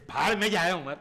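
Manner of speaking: loud, irritated dismissal; angry outburst. Acted. Husband curses the man his wife keeps praising, angry.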